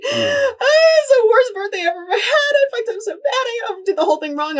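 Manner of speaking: high, loud wailing sob; crying to her husband. Retold or imitated. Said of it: "I'm like".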